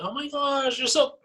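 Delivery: high-pitched, excited squeal of admiration; mock gushing nurse. Nurses fawning over a patient's veins, mock gushing.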